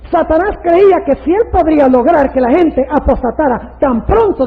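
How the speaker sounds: loud, high preaching delivery; forceful preaching. Real, not acted. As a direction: drive the argument home at full voice.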